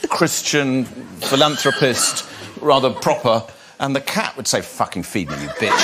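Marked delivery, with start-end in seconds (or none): 4.6-5.8 low, soft nasty cat voice. Retold or imitated. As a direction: voice the cat as a rude mother. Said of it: "cat voice".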